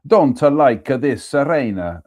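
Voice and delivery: put-on an Englishman's playful Italian accent, drawn out; hammed-up Italian accent, stretched slow. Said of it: "the inevitable Italian "ah".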